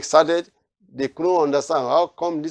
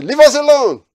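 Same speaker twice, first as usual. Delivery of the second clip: much higher and louder shrieking demon; demon crying out; 8 semitones higher, 7 dB louder than usual. Acted. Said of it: "that demon just manifests and says".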